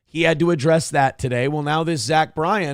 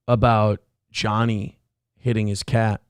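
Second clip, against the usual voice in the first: usual speaking voice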